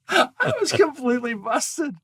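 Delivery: real laughter through the words; laughing. Real, not acted. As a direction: laugh at getting caught red-handed. Caught by the kid, laughing.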